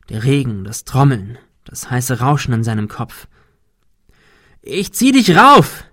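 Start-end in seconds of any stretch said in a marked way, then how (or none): 4.6-5.8 high, loud, drawn-out yell; screaming. A narrator's reading. Man to his friend dangling on the rope, screaming. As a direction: scream it down through the din. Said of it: "Sein Schrei verhallte im Getöse".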